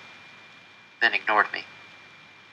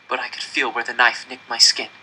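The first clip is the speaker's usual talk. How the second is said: plain reading voice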